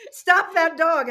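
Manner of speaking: loud, high imitation of the husband snapping; husband's shout. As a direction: bark it out like the annoyed husband. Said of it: "he goes".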